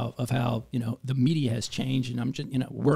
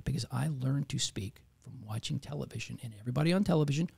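whispered aside; 5 dB quieter than usual. Real, not acted. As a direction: whisper it like a secret. Aside about TV voices.

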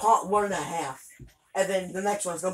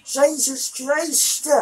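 exasperated; 8 semitones higher, 5 dB louder, much slower than usual. Real, not acted. Dying again in the game.

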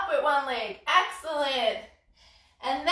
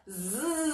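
soft fly buzz; 8 dB quieter than usual. Shown as a demonstration. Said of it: "give ourselves a zzz".

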